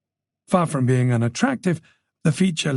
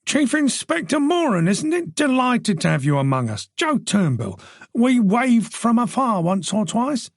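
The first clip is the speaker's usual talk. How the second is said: higher, jovial neighbour voice; a bluff, friendly husband glad-handing a guest; hearty host Joe; 9 semitones higher than usual. Acted. Joe welcoming the chief inspector at his party, higher and jovial. Joe Turnbull greets Moran.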